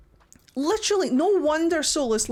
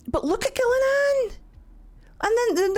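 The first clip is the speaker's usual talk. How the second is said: much higher, gushing; high awed praise; excited high exclamation; 9 semitones higher than usual. Real, not acted. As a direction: gush over her. Admiring the other god.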